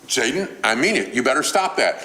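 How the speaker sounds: a mom losing patience with her son; rising mom warning. Retold or imitated. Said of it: "her voice gets in-, increase and increase".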